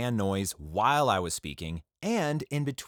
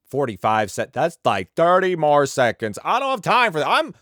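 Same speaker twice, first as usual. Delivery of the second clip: loud, high impatient protest; mock outrage; 7 semitones higher, 9 dB louder than usual. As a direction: rant like someone who can't spare seconds. Mocks someone too rushed for setup.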